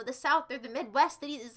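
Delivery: normal talking voice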